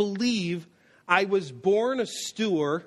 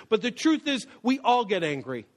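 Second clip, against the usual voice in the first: ordinary delivery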